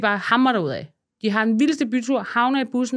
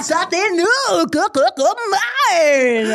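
higher, louder hyped chant; party cheer; 8 semitones higher, 5 dB louder than usual. Retold or imitated. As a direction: whip up the bus crowd.

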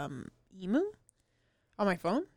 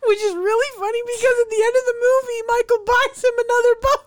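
much higher, louder, laughing delivery; bubbling over with glee; excited laughing; 11 semitones higher, 6 dB louder than usual. Real, not acted. Friend gleefully spoiling the ending, high and laughing.

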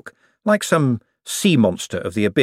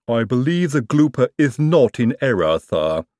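gloomy Igor; 3 semitones higher than usual. A narrator's reading. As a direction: drag it out, gloomy and resigned. Igor again, same voice.

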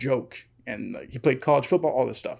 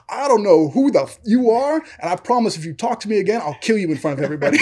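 higher, forceful mock threat; heated threat, laughter creeping in at the end; retold angry threat; 5 semitones higher, 3 dB louder than usual. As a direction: imitate the coach laying down a threat.

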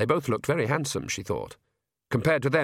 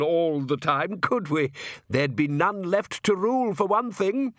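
smooth, self-satisfied aristocrat, delighted with himself; genial vampire count; 5 semitones higher than usual. Acted. Count reassuring the king about vampires, genially smug. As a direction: suave vampire charming his guests.

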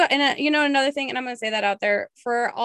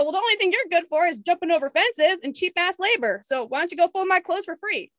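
higher-pitched acted retort; retold snarky comeback; 7 semitones higher than usual. Retelling her snap back at a girl, higher snarky retort. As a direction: throw the insult back, as retold.

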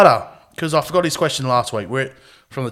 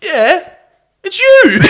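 much higher, louder mock jab; cheeky retort; 14 semitones higher, 7 dB louder, much slower than usual. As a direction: toss it back with a grin. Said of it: "and I go".

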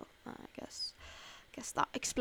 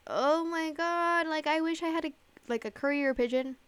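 mock dramatic; 6 semitones higher than usual. Host longing for a courier pigeon over phones, mock dramatic. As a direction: stretch it out high, hamming up the wish.

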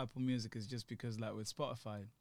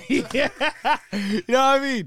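laughing; 8 semitones higher, 12 dB louder than usual. Real, not acted. Laughing loudly.

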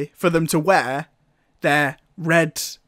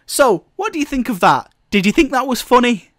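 higher, sing-song questions; talking to a child; 7 semitones higher than usual. Retold or imitated. Asking the four-year-old about the scene, higher child-directed voice. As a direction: coax a small child gently. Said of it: "and I said".

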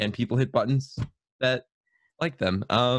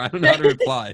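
laughing; 6 dB louder, faster than usual. Real, not acted. Laughs while being praised.

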